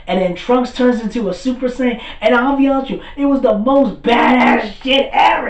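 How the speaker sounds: loud, high, amped-up retelling; hyped-up fan. Real, not acted. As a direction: gush about the most epic moment ever. Excited fan retelling the big moment.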